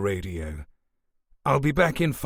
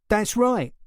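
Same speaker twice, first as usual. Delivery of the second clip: high nervous insistence; 10 semitones higher, 3 dB louder than usual. A narrator's reading. Suspect defending himself.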